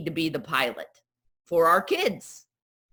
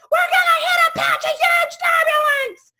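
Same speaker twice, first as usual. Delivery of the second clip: much higher, louder alarmed announcement; panicked pilot; 6 dB louder than usual. Retold or imitated. Pilot panicking over the intercom, high loud alarm. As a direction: sound the alarm to the cabin. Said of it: "that pilot gets on the overhead and says".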